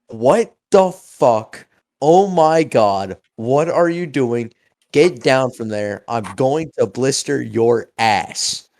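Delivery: loud, slow shouted outburst from an angry mom; mom screaming. Retold or imitated. Mom screams at the boy on the furniture, loud and slow. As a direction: yell it like a mom catching kids misbehaving. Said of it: "scream at the top of her lungs".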